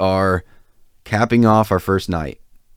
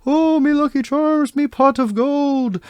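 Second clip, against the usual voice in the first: very high, slow hammed-up Irish brogue; mock Irish accent. Drunk cousin interrupting the Irish guys, mock Irish accent. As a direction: squeal it like a drunk doing a cartoon leprechaun. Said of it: "going like".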